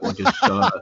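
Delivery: lightly put-on Russian accent, low; low, halting hint of a Russian accent; mock Russian worker. Foreign worker answering the English lady, lightly mock Russian accent. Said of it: "He's very foreign. He's just like".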